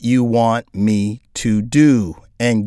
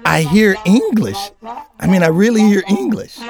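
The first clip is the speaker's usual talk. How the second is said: higher-pitched, mock bewilderment; baffled listener; 6 semitones higher than usual. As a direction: puzzle over it, pitch climbing on each try. Acts out baffled listener to droning teacher.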